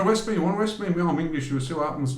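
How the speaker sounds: mock-defiant Englishman daring soldiers to arrest him; mock belligerent. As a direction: dare them to arrest you, hammed up. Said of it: "let me start being belligerent".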